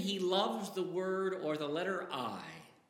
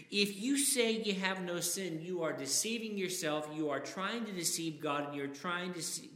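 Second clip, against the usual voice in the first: usual speaking voice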